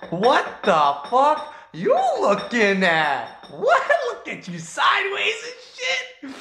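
imagined confrontational animal voice. Retold or imitated. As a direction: exaggerate the outraged challenge, stretched and high-pitched. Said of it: "he's like".

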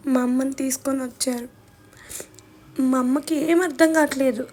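ordinary delivery

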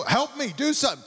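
high-pitched, exasperated begging for help; exasperated plea. Retold or imitated. Stuck caller to automated phone system, exasperated high plea. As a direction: plead with the machine in a high voice.

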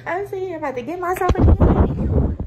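cracking up at the absurdity; laughing retelling. Real, not acted. Telling friends about her pedicure, she cracks up laughing.

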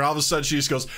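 ordinary talk, nothing marked

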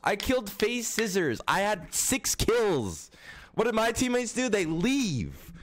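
real exasperated rant; frustrated rant. Real, not acted. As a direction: vent about useless teammates. Ranting about leaving teammates.

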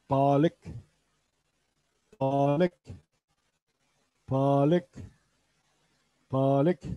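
Balık with a rising tune; Urdu pronunciation. Shown as a demonstration. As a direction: model Balık for students. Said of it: "ıspanak arkadaşlar". (Balık kelimesini yükselen tonla söyle)

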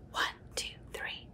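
soft breathy count under the breath; whispered counting. Acted. Reading to a child, counting apples in a whisper. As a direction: count quietly like a secret.

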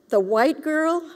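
plain reading voice

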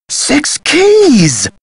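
very high, exclaimed discovery with a falling end; excited revelation. Acted. Man telling Nancy the tomb's secret, thrilled. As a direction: burst out with the answer. The clue finally clicks.

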